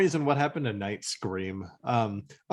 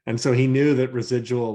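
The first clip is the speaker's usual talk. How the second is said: normal talking voice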